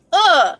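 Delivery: sharp high aggressive grunt; short fierce uh. Retold or imitated. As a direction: hit it like a fierce face. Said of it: "make an aggressive face".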